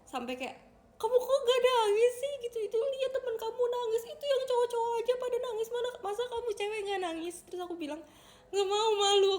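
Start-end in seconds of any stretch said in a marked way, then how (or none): 1.0-7.4 high, loud mock-scolding mom voice; loud, high-pitched retold nagging. Retelling mother's scolding, loud and high. 8.5-9.4 put-on high, loud childlike protest; mock-whining kid saying no; whiny refusal. Retelling own embarrassed refusal to mother.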